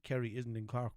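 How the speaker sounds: ordinary delivery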